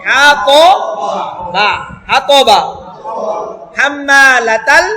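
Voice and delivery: drawn out slowly, sound by sound; slow syllable by syllable. Shown as a demonstration. Teacher spelling a word out syllable by syllable, slowly. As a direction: stretch each syllable apart for the class. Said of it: "এবার এটা হলো ভেঙে ভেঙে".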